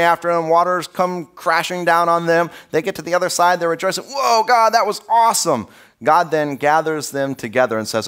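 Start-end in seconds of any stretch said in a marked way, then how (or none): 4.0-5.7 excited. Retold or imitated. Israelites rejoicing after Red Sea.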